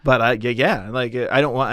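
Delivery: normal talking voice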